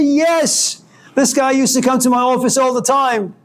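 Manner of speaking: high, bright-eyed surprise at spotting him; excited recognition. Retold or imitated. Professor spotting his former student by the lake, excited. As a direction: greet the old student with delight.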